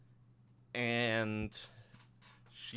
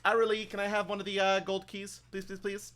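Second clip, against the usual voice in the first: high, loud customer-service voice; eager bank assistant; 10 semitones higher, 5 dB louder, faster than usual. Acted. Bank assistant asking for a gold key, high and eager. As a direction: chirp a request up high, then soften the pleas.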